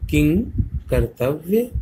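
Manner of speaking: किं said alone, then कर्तव्य; किं stressed high, then कर्तव्य; split demo. Shown as a demonstration. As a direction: separate किं from कर्तव्य with a pause. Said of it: "यहाँ से किंग को हम अकेले पढ़ेंगे".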